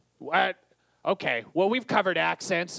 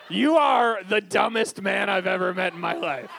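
normal talking voice

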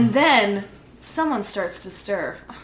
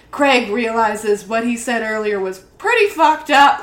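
ordinary talk, nothing marked

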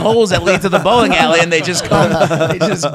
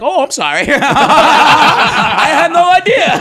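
high-pitched apology collapsing into laughter; hammed-up clueless sorry, cracking up; mock dumb apology; 11 semitones higher, 8 dB louder, much slower than usual. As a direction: laugh helplessly through a slow, loud mock apology. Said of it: "I'm so dumb. I'm like".

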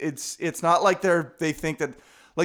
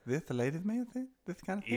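ladies' man voice; 3 semitones higher, 10 dB quieter, faster than usual. Acted. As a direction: purr it like a smooth seducer. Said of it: "do your ladies' man impression".

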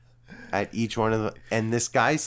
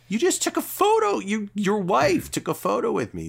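indignant protest; 12 semitones higher, 3 dB louder than usual. Retold or imitated. Billy protests to angry husband.

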